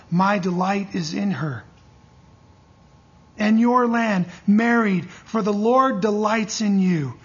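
solemn pulpit reading of Isaiah; scripture reading. Acted. Reads long Isaiah passage.

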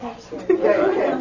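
laughing through the words. Real, not acted. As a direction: answer the joke while laughing. Answering the joke, laughing.

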